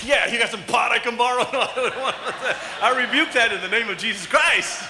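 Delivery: loud laughing play-act; laughing preacher joke; laughing mock quote. Joke about pot, laughing.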